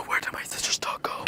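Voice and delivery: gasp, then whisper the question; worried whisper. Real, not acted. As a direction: whisper in alarm that the dog is gone. Gasps, dog has gone missing.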